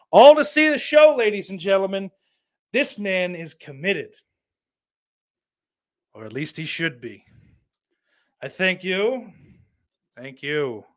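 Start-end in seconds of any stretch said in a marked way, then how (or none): 0.1-4.1 high, loud ringmaster call; showman announcer. Pretend. 8.4-9.3 higher, hammed-up showman thank-you; milk the applause; mock grand thanks. Mock bow after a joke.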